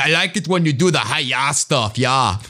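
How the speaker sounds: put-on hammy, off-target Austrian accent; bad Arnold Schwarzenegger impression. Arnold chatting to the host about his show, badly faked Austrian accent. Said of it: "I don't do a great Arnold impression".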